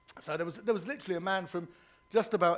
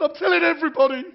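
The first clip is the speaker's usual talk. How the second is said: gleeful boast; 12 semitones higher, 15 dB louder than usual. Acted. Punchline of old man's confession.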